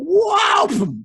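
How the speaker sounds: high shouted whoa; high startled shout; loud whoa. Acted. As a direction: yelp on landing. Frog lands over wall.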